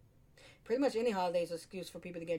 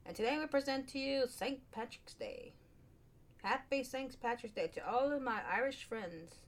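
put-on higher-pitched, off-target Irish accent; attempted Irish accent; 5 semitones higher than usual. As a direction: have a go at a lilting Irish brogue and miss. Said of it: "I don't know what accent I'm trying to".

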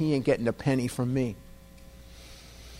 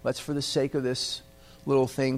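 normal talking voice

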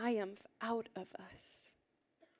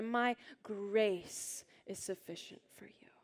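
slow, with "grace" drawn out and emphasized; stress on grace; slower than usual. Shown as a demonstration. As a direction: lean hard on "grace", leading a room reading aloud. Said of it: "The emphasized word should be grace".

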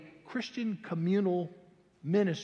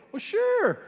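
eager yes; 7 dB louder than usual. Retold or imitated. Eagerly agrees to witness.

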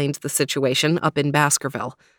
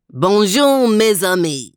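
higher, louder, grand French hello; French-flourished greeting; 4 semitones higher, 6 dB louder than usual. A narrator's reading. Max the dog greets his three friends in French, grandly.

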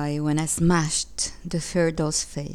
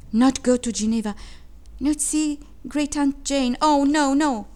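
high, pleading disbelief; child's high, alarmed plea; dismayed child; 6 semitones higher, 3 dB louder than usual. A narrator's reading. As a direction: protest in dismay, building to a louder no. Child dismayed by father's threat.

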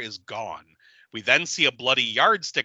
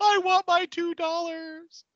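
much higher and slow, a pitched-up joke line; mock whine. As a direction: whine it like a kid demanding money. Quoting a cult movie line.

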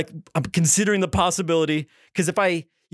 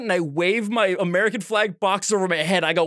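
usual speaking voice

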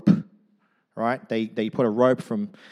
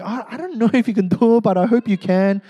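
high, loud hopeful crowd voice; half-doubting cheer, pitched up; hopeful doubtful crowd; 10 semitones higher, 6 dB louder than usual. Retold or imitated. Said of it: "this sort of like".

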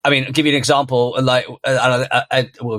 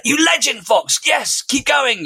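much higher, cheering him on step by step; excited cheering; 14 semitones higher, slower than usual. Retold or imitated. Dad cheering his son across the rope, excited. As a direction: cheer like his biggest fan. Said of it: "words of encouragement and this genuine excitement".

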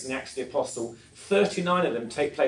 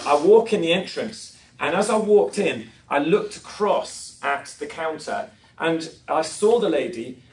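normal talking voice

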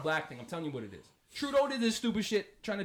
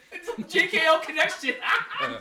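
high, loud laughing; 8 semitones higher, 5 dB louder than usual. Real, not acted. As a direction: laugh through the words. Laughing at the joke.

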